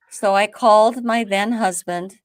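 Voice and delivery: ordinary delivery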